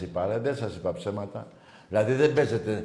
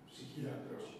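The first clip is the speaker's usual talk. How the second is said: whispered; 19 dB quieter than usual. Real, not acted. Repeats caller's word.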